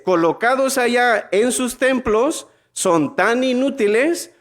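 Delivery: lifted preacher's scripture voice; scripture reading. Acted. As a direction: read the verse aloud to the crowd. Reads Bible verse aloud.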